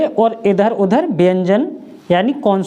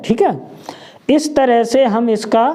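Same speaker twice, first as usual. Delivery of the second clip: normal talking voice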